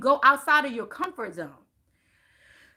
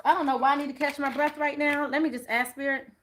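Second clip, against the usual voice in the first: ordinary talk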